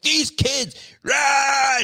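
high, loud mock meltdown; frantic outburst. Husband mimicking wife's meltdown over kids, high frantic yell. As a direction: imitate wife losing it at the kids. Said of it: "she's like".

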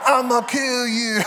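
high, drawn-out mock threat; toy fight voice. Son playing with two Spider-Man toys, high mock menace. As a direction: slowly play a kid's toy villain.

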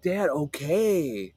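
slow, dragged-out reluctant reply; lightly voiced grudging son, very slow; mock sulky son. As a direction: drag it out like a teen giving in. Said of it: "And Cliff's like".

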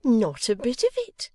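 high, gleeful dismissal; teasing exclamation; delighted cry. Acted. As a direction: pitch it up and relish the denial. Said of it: "cried Missus Boffin".

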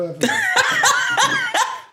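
very high, loud burst of laughing; laughter. Real, not acted. Host laughs, caught telling mom he's recording, loud burst.

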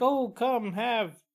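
high, sugary mock-host invitation; sing-song fake host offering food; fake hospitality. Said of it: "they're like".

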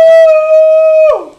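high, loud shocked gasp of oh. Retold or imitated. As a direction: caught out in front of the class. Retold shock at being exposed.

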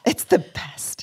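soft, breathy echo; whispering. Pretend. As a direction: hush it like a secret. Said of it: "echo game, whispered".

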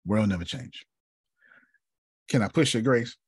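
usual speaking voice

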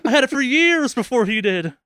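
imitating the rival whining; mock outrage. Imagining the other gamer, mock indignant. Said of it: "constantly like".

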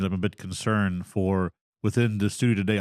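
usual speaking voice

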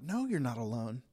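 quiet reassuring reply; gentle reassurance. Retold or imitated. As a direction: comfort him quietly. Reassuring the struggling man.

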